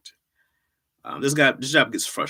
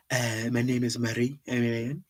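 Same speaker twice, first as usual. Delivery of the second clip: lightly put-on French accent; a self-introduction in halting French accent. Streamer introduces himself as Marie in a mock French accent. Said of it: "That was my French accent".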